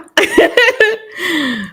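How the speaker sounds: high laugh breaking through tears; tearful laugh. Real, not acted. Mother laughing through tears about her son talking. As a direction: laugh to keep from crying.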